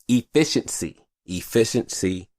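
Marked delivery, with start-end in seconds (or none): none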